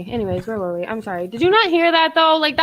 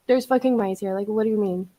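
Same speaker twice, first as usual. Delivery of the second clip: ordinary delivery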